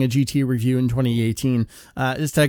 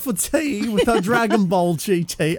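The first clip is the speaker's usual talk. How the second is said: mock posh British accent, raised pitch; 6 semitones higher than usual. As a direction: ham up an English accent over tea. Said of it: "boasting about your British accent, and that was just appalling".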